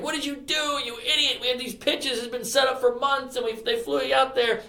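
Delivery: high, softened mock-furious scolding; retold fury. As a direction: imitate a furious manager scolding, without shouting. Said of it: "he's, like, furious at me".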